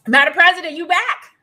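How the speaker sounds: loud, high greeting call. Real, not acted. Host calling out to the returning Madam President, loud high greeting. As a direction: call her back in warmly and loud.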